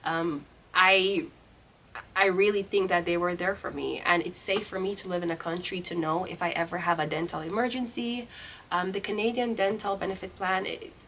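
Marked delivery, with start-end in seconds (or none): none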